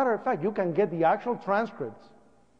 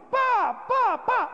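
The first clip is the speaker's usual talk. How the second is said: imitated smashing blows; much slower than usual. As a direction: punch out each bang, high and sharp. Imitates boot smashing the glass.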